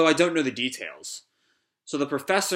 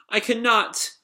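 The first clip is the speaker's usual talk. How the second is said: high, helpless refusal; pleading android; 8 semitones higher, slower than usual. Acted. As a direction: refuse in a small high robot voice.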